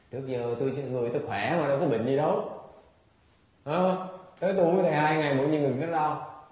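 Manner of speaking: indignant complaint. Acted. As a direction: complain at full volume, blaming the teacher. Voices a disgruntled student blaming the teacher.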